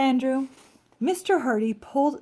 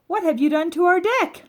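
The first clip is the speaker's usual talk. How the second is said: high, loud cry of dismay; shocked homeowner yelling at his wrecked deck; dismayed outcry; 8 semitones higher, 7 dB louder than usual. Acted. Mr Hardy cries out at his sanded deck, loud and dismayed.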